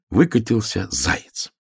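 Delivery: plain reading voice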